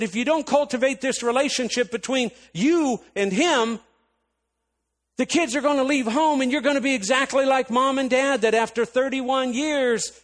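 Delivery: loud, very high-pitched warning; retold tirade at its peak. Warning sister she'll end up divorced, loud heated tirade. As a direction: hammer it home at full volume. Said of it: "a tirade with me going off on my sister".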